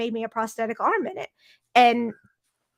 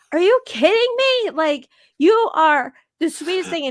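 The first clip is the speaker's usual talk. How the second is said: high, loud, stretched disbelief; retold "you can't be serious" outburst; mock incredulity; 7 semitones higher, 6 dB louder than usual. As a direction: exclaim it as affectionate, over-the-top disbelief. Acted quote of her incredulous reply.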